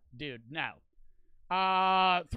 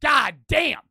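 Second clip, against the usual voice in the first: shouted god damn; 6 semitones higher, 11 dB louder than usual. Real, not acted. Shouted amazement.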